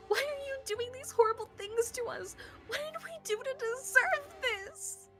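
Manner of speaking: high, very loud anguished wail; desperate scream. Acted. June at the door demanding why, desperate high scream. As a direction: cry out at the captors, frantic and desperate. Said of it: "She screamed again, a desperate, mindless cry".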